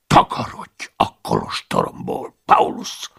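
severe order to get out; stern command. Acted. The abbot sternly orders Paulus out of the monastery. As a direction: throw him out sternly. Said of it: "mutatott szigorúan az ajtó felé".

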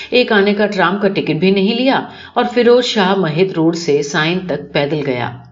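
usual speaking voice

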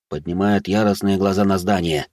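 ordinary delivery

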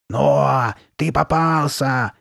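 loud, slow, gloating monster shout; drawn-out evil threat; malicious monster cry. A narrator's reading. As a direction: stretch it out wickedly like a monster pouncing. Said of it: "крикнула Карли Бет злобным басом".

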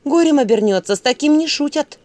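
emboldened warning. A narrator's reading. Василиса warns the father about matchmakers, emboldened. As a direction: warn boldly of disaster. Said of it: "осмелела Василиса".

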